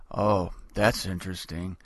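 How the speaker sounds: low, unbothered deadpan; deadpan calm Jesus. Retold or imitated. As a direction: answer as a Zen master who feels nothing. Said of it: "he goes".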